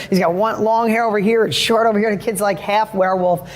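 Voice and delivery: usual speaking voice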